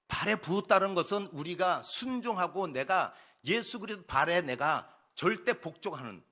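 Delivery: usual speaking voice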